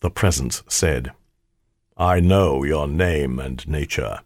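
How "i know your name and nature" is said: low, slow all-knowing spirit; spirit of the ring. A narrator's reading. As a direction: state it slowly and low, like an ancient spirit.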